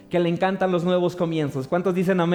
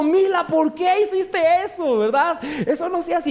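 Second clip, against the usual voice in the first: high, half-laughing alarmed scold; frightened scolding; 7 semitones higher than usual. Retold or imitated. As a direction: scold the baby, laughing at your own fright. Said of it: "Yo estaba asustado".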